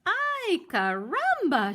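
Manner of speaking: high excited chicken cry. Acted. Hen in the nest exclaims, high loud cry. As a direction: burst out in a high squeal. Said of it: "she cried".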